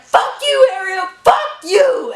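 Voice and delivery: angry shout. Retold or imitated. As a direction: scream it at the girlfriend, imitating him. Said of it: "Victor's like".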